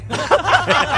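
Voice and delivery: sudden high squeal of a laugh; high burst of laughter. Real, not acted. Gamer laughing as a teammate goes down, a high squealing laugh. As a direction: break into a high giggle.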